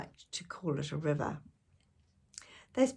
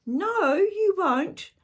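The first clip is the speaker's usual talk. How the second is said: higher, louder, slow insistence; mock protest; 12 semitones higher, 7 dB louder, much slower than usual. A narrator's reading. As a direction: insist like a child on a race. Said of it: "said Annie".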